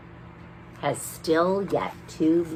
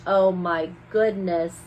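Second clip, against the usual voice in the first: usual speaking voice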